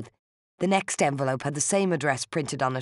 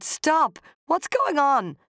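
much higher, loud panicked shout; desperate shout; 13 semitones higher, 3 dB louder, much slower than usual. A narrator's reading. Narrator shouting at Rosaleen to stop, high and loud. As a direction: cry out to make her stop. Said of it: "I shouted".

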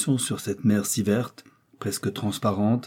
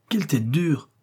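higher-pitched marvelling; amazed exclamation; 6 semitones higher than usual. A narrator's reading. Joey sees the bullet flatten on the skull, amazed exclamation. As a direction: exclaim at how tough it is.

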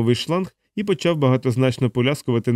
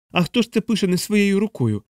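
high-pitched outraged question; indignant cry; 8 semitones higher than usual. Acted. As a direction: cry out in protest, pitch raised. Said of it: "заволав капелан".

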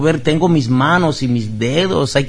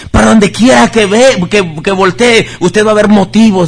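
higher, louder preaching voice; fervent preaching; 7 semitones higher, 5 dB louder than usual. Real, not acted. Pastor urging the congregation to give thanks, fervent loud preaching.

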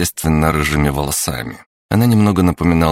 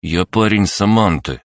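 dignified; 6 semitones higher, 3 dB louder than usual. A narrator's reading. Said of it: "с чувством собственного достоинства произнёс".

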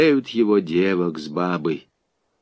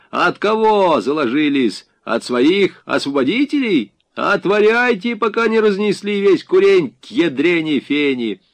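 raised, high-pitched demand from outside; shouted threatening order; 7 semitones higher, 4 dB louder than usual. A narrator's reading. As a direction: bellow threats through a locked gate. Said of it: "приказали с улицы". (угрожающий окрик с улицы)

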